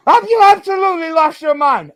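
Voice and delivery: loud, high, exasperated outburst; outraged rant. Real, not acted. Streamer yelling at chat over a comment, loud outraged disbelief. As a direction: blast the question at chat in disbelief.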